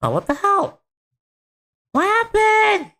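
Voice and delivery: sudden high alarmed yelp; real shock at the screen; startled surprise. Real, not acted. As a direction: caught off guard, voice jumps up high. Startled by the video.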